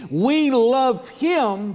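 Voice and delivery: very slow, stretched words; drawn-out verse. Acted. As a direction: linger on each word of the verse. Quoting verse sung this morning.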